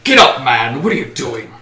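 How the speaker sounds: mildly gruff order; understated gruff reprimand. Acted. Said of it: "with a gruff".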